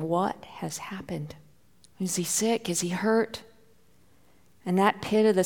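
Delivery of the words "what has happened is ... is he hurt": hushed anxious inner questions, much softer; worried whisper. Retold or imitated. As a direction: whisper the fears to yourself. Anxious thoughts on way over.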